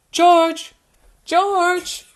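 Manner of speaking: high, loud call for George. Acted. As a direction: shout for the lost brother. Calling for lost brother.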